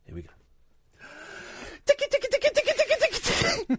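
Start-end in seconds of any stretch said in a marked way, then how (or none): 1.8-3.8 giggly tickle attack. Pretend. Tickling the caller on air.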